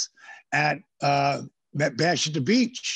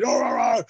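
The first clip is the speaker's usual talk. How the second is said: mock angry ranting; 8 semitones higher than usual. Imitating angry wrestlers yelling at him, mock ranting. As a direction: rattle it off like an angry locker room.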